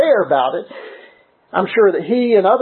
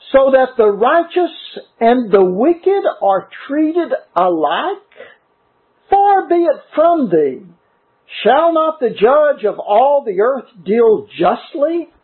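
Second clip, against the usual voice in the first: higher, slow pleading read; fervent scripture reading; 5 semitones higher, slower than usual. Acted. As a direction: preach the verse as Abraham's plea. Preacher reads scripture with fervor.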